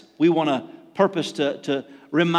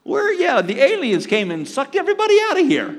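mock bewildered people; 10 semitones higher, 4 dB louder than usual. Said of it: "people are saying".

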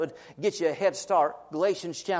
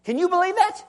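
much higher incredulous question; mock disbelief; 11 semitones higher, 3 dB louder than usual. Real, not acted. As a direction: act amazed for the crowd.

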